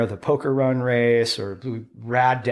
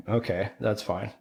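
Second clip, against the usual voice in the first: resigned quiet; 4 semitones lower, 6 dB quieter than usual. Retold or imitated. Resigned inner thought.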